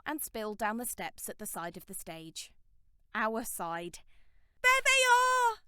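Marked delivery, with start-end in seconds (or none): 4.6-5.6 high, loud crowd scream. A narrator's reading. A fan spotting the band, screaming high and loud. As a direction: shriek it across the crowd. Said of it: "screamed someone".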